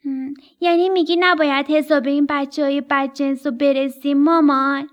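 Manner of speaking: little birds begging to get even; young storks. Acted.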